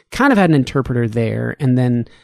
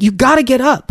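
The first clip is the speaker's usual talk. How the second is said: pitched-up retold order from an angry nurse; furious nurse; 9 semitones higher, 3 dB louder than usual. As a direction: imitate her insisting, mildly angry. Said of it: "fury in her eyes".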